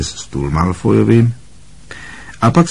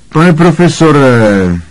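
high, slow, drawn-out appeal to the teacher; protahovaně; 8 semitones higher, 6 dB louder, slower than usual. A narrator's reading. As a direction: draw it out, pitched high and loud. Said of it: "protáhl Malfoy". (protáhle, vysokým hlasem)

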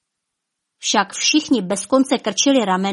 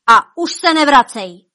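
higher, louder banishing yell; shouted; 6 semitones higher, 8 dB louder than usual. Acted. King yelling after Death into the park. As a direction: hurl it out the window. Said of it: "vykřikl do parku".

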